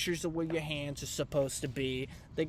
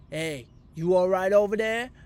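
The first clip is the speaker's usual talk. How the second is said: loud, higher voiced stranger calling over; mock guy; 5 semitones higher, 9 dB louder than usual. Stranger calls from the country club lawn, loud and higher. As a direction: call out like a suspicious local.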